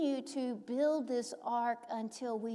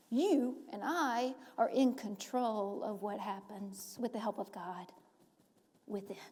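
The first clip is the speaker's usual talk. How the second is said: ordinary talk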